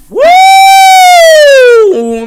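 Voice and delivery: very high, loud drawn-out whoop; stunned whoop. Real, not acted. Streamer reacting to the pick with a long whoop. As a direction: let out a long wild whoop of disbelief.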